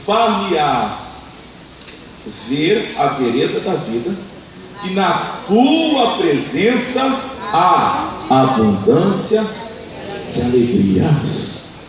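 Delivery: very slow, drawn-out reading of the verse; slow scripture reading. Shown as a demonstration. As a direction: stretch each word of the verse out slowly. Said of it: "Vamos ler de novo".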